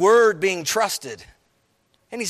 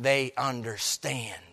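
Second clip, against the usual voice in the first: hushed whisper; 8 semitones lower, 6 dB quieter, slower than usual. Acted. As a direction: breathe it out in a whisper. Quiet awe after resurrection.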